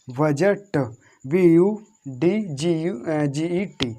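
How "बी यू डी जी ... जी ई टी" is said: spelling out letters. Shown as a demonstration.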